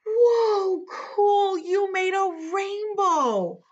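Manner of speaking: high, stretched-out awe; amazed. Acted. Storyteller to kids who made a rainbow, high amazement. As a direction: gush with wonder for small children.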